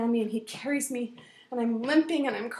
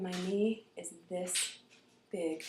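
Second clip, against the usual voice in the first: slow reveal; 3 semitones lower, 6 dB quieter, slower than usual. A narrator's reading. Dramatic reveal of swelling.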